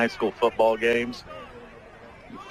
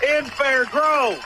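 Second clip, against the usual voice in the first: soaring excited touchdown call; 11 semitones higher than usual. Real, not acted. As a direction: ride the play up to a big high call. Touchdown call on live radio.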